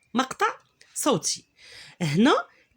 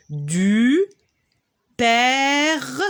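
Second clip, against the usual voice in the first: ordinary talk, nothing marked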